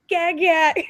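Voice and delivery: put-on high gurgling baby babble; mock baby noises, high and giggly. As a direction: babble like an infant. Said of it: "babbling just incoherently".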